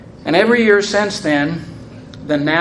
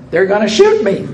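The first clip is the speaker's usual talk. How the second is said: laughing; 5 semitones higher, 5 dB louder than usual. Retold or imitated. New Yorker's punchline retold, laughing.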